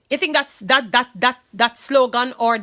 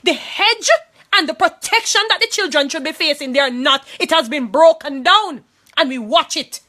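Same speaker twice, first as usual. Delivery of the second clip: raised, high and loud, preaching against the neglect; impassioned outcry; 6 semitones higher, 7 dB louder than usual. Real, not acted. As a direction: cry it out with rising fury. Preaching outrage at child neglect.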